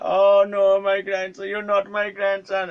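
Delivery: high, loud imitated wail; hammed-up dismay, drawn out at the end; upset old man. Old man realizing his mistake, upset. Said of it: "he got really upset".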